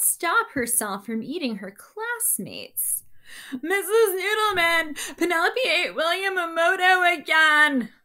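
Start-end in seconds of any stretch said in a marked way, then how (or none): 3.6-7.9 put-on high, loud tattletale kid voice; tattling. Classmate tattling loudly to Missus Noodleman. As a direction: call out to the teacher, telling on her.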